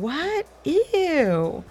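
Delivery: high disgusted What? Ew; grossed out. Retold or imitated. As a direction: squeal in disgust. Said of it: "you're probably thinking".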